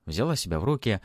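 ordinary delivery

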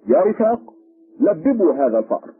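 high, loud command; calling out. A narrator's reading. Policeman ordering his companions to seize prisoner, loud high call. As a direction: call the men to seize him.

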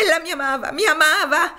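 high, loud, anguished sobbing; sobbing exclamation. Acted. Topsy cries that she'll never see Eva again, sobbing loudly. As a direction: wail it through tears. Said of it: "I suoi singhiozzi raddoppiarono".

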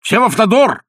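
high, loud, rushed slogan; hasty shout. A narrator's reading. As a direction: blurt the slogan out at full voice. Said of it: "поспешно сказал он".

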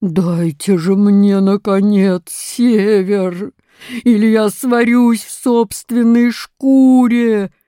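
slow, loud bear roar; big bear's loud, drawn-out demand. A narrator's reading. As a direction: bellow with long stretched words. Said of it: "Белый медведь ревел так".

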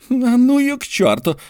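high grumbling brush-off; Mordecai grumbling. A narrator's reading. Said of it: "проворчал Мордехай".

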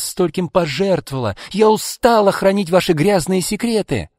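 high, loud, indignant outburst; exploding in anger. A narrator's reading. Izolda erupts at Arkady's refusal, indignant and angry. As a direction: demand and accuse, building to a loud peak. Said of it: "взорвалась Изольда".

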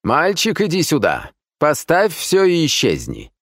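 high, brisk command; imperious guest ordering a servant. A narrator's reading. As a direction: order the porter away. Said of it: "Последние слова адресовались мужику в ливрее". (повелительно, высоким голосом)

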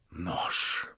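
hissed, breathless; whispered in fury. A narrator's reading. Stavrogin whispers to himself in fury. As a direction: breathe the word out in rage. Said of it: "повторял он в неутолимой злобе".

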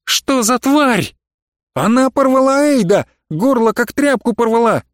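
высокий, надрывный крик издалека без лишней громкости; выкрикни высоко, в панике; испуганный выкрик. A narrator's reading. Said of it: "чей-то голос выкрикнул". (высоким паническим криком)

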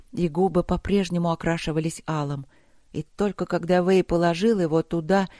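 ordinary delivery

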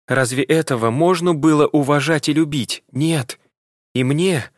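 upset daughter. Acted. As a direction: pour out the old hurt. Daughter's bitter outburst about father.

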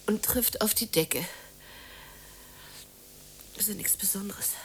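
hushed whisper. Acted. Woman alone in her dark room, whispering to herself.

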